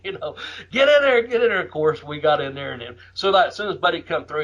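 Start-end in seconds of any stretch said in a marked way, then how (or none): 0.7-1.6 high, loud mock crowd yell. Fans urging the wrestlers into the ring, loud mock yell. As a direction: shout like a rowdy fan, pitched way up. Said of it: "fans still fucking yelling".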